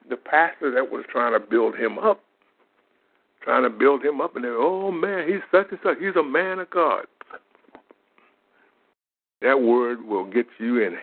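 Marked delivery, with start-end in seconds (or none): 4.5-7.1 high, mock-gushing praise, clearly higher. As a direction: lay on the fake reverence thick. Mocking the pastor's praise.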